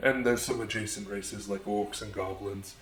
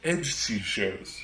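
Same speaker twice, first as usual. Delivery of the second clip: slow emphasis; much slower than usual. Acted. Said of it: "as I'm now calling them".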